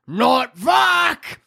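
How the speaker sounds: high-pitched ogre shout; short monstrous battle roar. Acted. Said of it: "the ogre roars".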